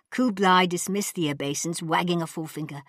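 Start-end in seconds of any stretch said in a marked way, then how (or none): none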